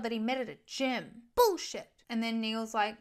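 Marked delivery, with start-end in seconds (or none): 1.3-1.9 loud, high mock outburst; outraged retort. Girlfriend dismissing his excuse about marriage, outraged. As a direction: punch it out high and indignant.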